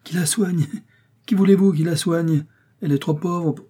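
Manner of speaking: high, indignant outburst; exclaiming. A narrator's reading. Workman answers the doctor about the poor sick mother, exclaiming. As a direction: throw the question back in a high exclamation. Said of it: "Fernand s'écria".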